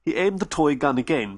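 plain reading voice

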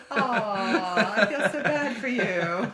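high, loud, laughing fake sympathy; over-the-top pity, laughing; laughing mock pity. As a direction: tease him through a laugh. Teasing mock pity to husband.

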